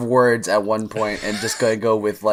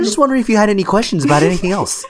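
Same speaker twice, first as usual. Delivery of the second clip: fast, higher polite phone patter; 7 semitones higher, much faster than usual. Acted. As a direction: chirp through a mock professional call.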